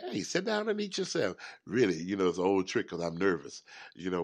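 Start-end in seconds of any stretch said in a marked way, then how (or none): 0.1-1.4 a lightly voiced relative, much higher; imitating a relative. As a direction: mimic a family member urging the host to sit and eat. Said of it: "But some people".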